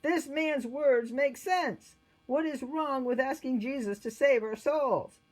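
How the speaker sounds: high, loud proclamation; exclaiming. A narrator's reading. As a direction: announce a sudden conviction to the court. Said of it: "stood up and exclaimed".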